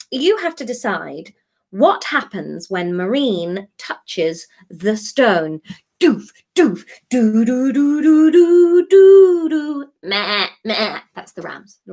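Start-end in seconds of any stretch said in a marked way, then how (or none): 0.1-5.6 slow, weighty build to the stone; dramatic announcement. Acted. Announcing the big decision dramatically. 6.0-9.9 sung dum-dums climbing higher and louder; mock suspense music. Mimicking dramatic suspense music.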